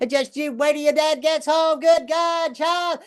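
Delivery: very high, mock-screeching Southern mom; mom's primal scream. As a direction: climb to an imitated motherly shriek. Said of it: "to a primal scream".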